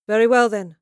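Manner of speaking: loud, firm declaration; a queen's ringing decision; declaring. A narrator's reading. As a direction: proclaim it to the tent. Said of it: "she declared".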